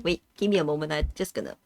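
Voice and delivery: quiet, catching breath after coughs; recovering from a cough fit. Real, not acted. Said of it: "I start coughing".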